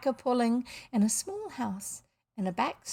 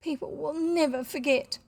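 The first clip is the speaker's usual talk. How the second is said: higher-pitched plaintive lament; wailing; 6 semitones higher than usual. A narrator's reading. Mother lamenting the ruined party, wailing. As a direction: wail it like a mortified hostess. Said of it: "wailed Missus Brown".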